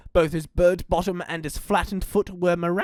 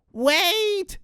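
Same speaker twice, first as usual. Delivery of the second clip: called out; 14 semitones higher than usual. Acted. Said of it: "called Frederick".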